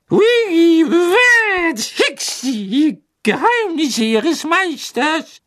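hoch, laut, sehr langsam, den Tränen nah; trotzige Elfe. A narrator's reading. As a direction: schluchz trotzig wie eine betrunkene Elfe. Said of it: "sagte sie trotzig". (hohe, trotzig weinende Elfenstimme)

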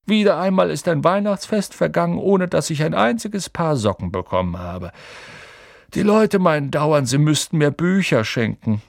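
high, animated mock complaint from the mentor; Dumbledore, playful lament. A narrator's reading. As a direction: lament it lightly, with exaggerated lilt.

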